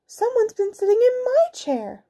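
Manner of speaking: very high mother bear voice. A narrator's reading. Mother bear finds chair used, high indignant voice. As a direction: complain in a high falsetto.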